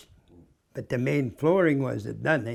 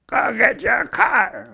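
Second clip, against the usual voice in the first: gruff boss shouting an order, high and louder; hollered order; 10 semitones higher, 4 dB louder, slower than usual. Retold or imitated. Superintendent hollers at his worker, gruff shout. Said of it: "And he hollered at me".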